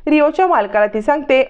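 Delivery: ordinary delivery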